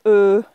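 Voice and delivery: loud, held "e", as euh; sustained rounded euh for "e"; French filler. Shown as a demonstration. French teacher repeats the surprising filler, loud and held. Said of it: "which is French".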